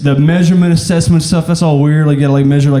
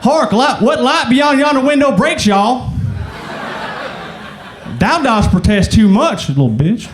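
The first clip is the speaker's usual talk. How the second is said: hammed-up Shakespeare in a Southern twang; Southern-accent Shakespeare; 7 semitones higher than usual. Theater student from Arkansas acting Shakespeare, hammed-up Southern accent. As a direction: declaim the Bard with a Southern drawl. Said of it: "do Shakespeare without a southern accent".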